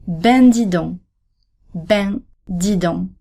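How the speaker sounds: "ben dis donc" with nasal ben, slow and careful; "ben dis donc", silent c at the end; model pronunciation. Shown as a demonstration. Teacher models the second form slowly for learners. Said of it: "There are two ways of saying this expression".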